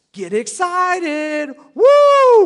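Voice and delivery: drawn-out cry into a high, loud whoop; hyped-up excitement. Acted. Preacher acting out joy at trials, hyped up. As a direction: stretch it out, then explode with a whoop.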